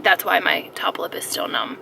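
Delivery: ordinary talk, nothing marked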